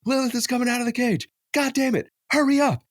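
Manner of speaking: high, loud panicked shout across the room; frantic yell. Retold or imitated. Yelling to his wife to grab the sprayer, frantic. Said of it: "I'm like".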